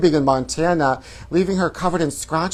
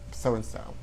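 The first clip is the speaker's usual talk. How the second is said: ordinary delivery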